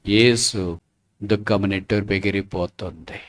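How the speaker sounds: low, hushed declaration; solemn preaching. Real, not acted. Preacher tells mourners that Jesus has risen, solemn and soft.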